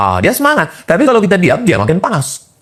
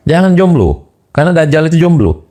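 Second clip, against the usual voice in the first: higher, teasing voice of the devil; mock devil's voice; 7 semitones higher than usual. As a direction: tease like a sly devil. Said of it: "Apa kata setan?". (suara setan menggoda, agak tinggi)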